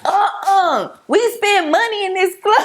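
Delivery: high, indignant protest at the bouncer; hammed-up outrage at being thrown out; mock club protest. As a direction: play the wronged customer getting kicked out. Bouncer kicking them out, mock protest.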